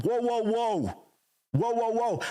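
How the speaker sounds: high, slow drawn-out whoa, whoa, whoa; mock startled whoa. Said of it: "and I'm like".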